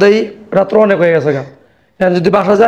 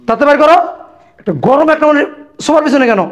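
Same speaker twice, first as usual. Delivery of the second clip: high-pitched indignant questioning; indignant demand; 6 semitones higher than usual. Acted. Father-in-law confronts son-in-law about the sofa, indignant demand. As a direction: press hard for an answer.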